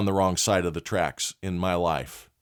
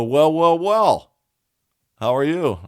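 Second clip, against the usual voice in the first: higher and louder, mock-menacing cop; mock state trooper; 8 semitones higher, 9 dB louder, slower than usual. Trooper catching kids in the dark, higher and louder, mock-menacing. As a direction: ham up a cop who's caught you.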